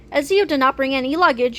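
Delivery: ordinary delivery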